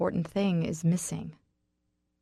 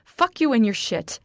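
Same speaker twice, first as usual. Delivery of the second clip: louder, higher angry retort; raging at him; furious outburst; 5 semitones higher, 5 dB louder than usual. Acted. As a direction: hurl it at him. She explodes at Raynor.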